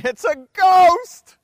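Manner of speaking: high, loud, slow panicked cry; terrified cry. A narrator's reading. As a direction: shriek it out as if seeing a ghost. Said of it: "they were troubled".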